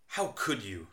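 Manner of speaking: teasing fake outrage, not really angry; joking reproach over a forgotten book; sarcastic mock anger. Shown as a demonstration. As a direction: pretend to be upset, just joking. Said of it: "used in a sarcastic way".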